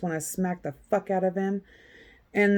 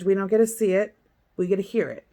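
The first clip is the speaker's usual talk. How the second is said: ordinary talk, nothing marked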